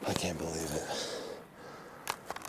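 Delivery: slow awed whisper. Real, not acted. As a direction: breathe it out in wonder. Awed in the woods.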